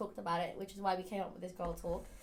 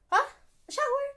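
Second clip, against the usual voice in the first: much higher, loud mock outrage; mom's shocked refusal; 12 semitones higher, 9 dB louder than usual. Mom forbidding a shower, high outraged. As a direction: play mom aghast.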